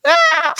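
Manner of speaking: put-on very high, loud baby wail; imitated newborn cry. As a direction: squeal like a newborn. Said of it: "he was like".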